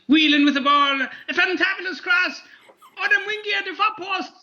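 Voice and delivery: high, excited Nigerian-Irish commentator; a Nigerian-Irish commentator building up; Nigerian-Irish commentary. Shown as a demonstration. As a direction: call the cross in a Nigerian-tinged Irish accent. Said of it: "Sorry, that's Nigerian, mixed with Irish".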